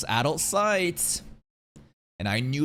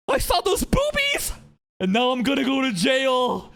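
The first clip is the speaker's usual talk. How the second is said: hammed-up kid panic; 10 semitones higher, 5 dB louder than usual. Acting out childhood self fearing jail, mock panicked wail. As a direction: imitate a child melodramatically dreading doom. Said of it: "kid me, I was like".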